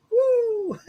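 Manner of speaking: high whoop into a laugh. Real, not acted. Bursting with joy after the light fills the body, whooping laugh. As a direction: let out a giddy woo and laugh.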